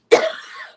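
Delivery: rough choking cough; coughing up water. Real, not acted. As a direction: hack it out, choking. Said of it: "It went down the wrong way".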